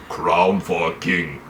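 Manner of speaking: very slow, low warlord voice; low put-on brute with few words; Khal Drogo. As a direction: drag out each word, low and heavy. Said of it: "That's such a good impression".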